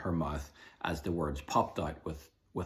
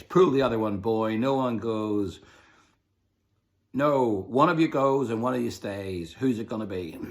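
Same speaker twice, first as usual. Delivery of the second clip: louder, higher pirate demand; captain forcing the children to choose; scornful pirate captain; 3 semitones higher, 8 dB louder than usual. A narrator's reading. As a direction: sneer then demand an answer. Captain mocking then demanding.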